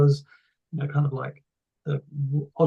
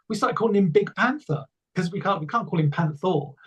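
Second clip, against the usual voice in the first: usual speaking voice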